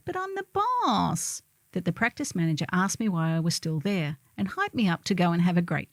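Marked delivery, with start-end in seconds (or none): 0.1-1.4 put-on high, slow, childish whine; whining. Boss caught sneaking out the window to the practice manager, whining. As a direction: whine it like a stubborn kid who won't leave.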